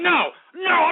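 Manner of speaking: sharp shouted outburst; angry refusal. Real, not acted. Host refusing chat room shout-outs, angry refusal. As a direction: refuse in a flash of temper.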